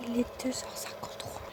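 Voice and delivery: hushed late-night whisper. Real, not acted. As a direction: whisper so nobody wakes. Night whisper, can't sleep.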